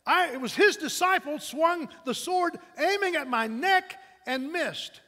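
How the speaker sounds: loud, high-pitched bitter outburst; angry accusation. Acted. Wounded Malchus blaming the disciple with the sword, angry accusation. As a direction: hurl the grievance out in punched phrases. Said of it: "he hissed".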